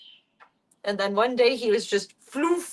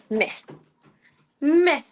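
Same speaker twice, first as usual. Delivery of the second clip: mock meh; 6 semitones higher, 4 dB louder than usual. Mock grumble at cat.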